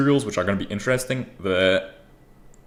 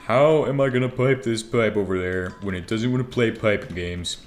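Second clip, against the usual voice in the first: normal talking voice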